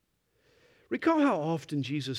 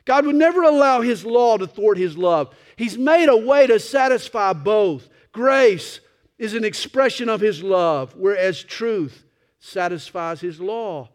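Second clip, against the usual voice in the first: rising, louder preacher's cry; preaching; 6 semitones higher, 10 dB louder than usual. Acted. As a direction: build the sermon to a peak. Preaching at full force.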